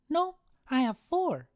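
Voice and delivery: put-on high, insistent child voice; little kid. Son contradicts his dad, high-pitched kid voice.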